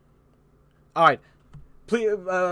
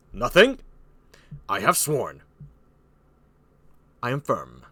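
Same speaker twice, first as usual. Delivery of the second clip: unyielding King Lear; 4 semitones lower than usual. Acted. Lear refusing Burgundy, firm kingly voice. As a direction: hit the first word, then sink low.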